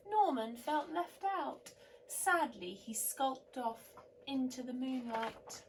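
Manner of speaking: lively picture-book reading; story narration. A narrator's reading. Reading a bedtime story to children, animated. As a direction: read it like a bedtime story to little ones.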